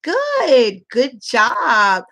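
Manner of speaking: put-on lavish praise on a young learner; praising teacher. Shown as a demonstration. Teacher praising child for a good answer, slow and bright. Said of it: "I'm like".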